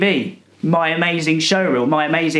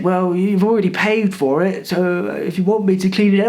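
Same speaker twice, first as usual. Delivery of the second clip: lightly voiced old cleaner, a touch higher; a hint of a fussy old man; mock old man; 3 semitones higher than usual. Retelling the cleaner's words.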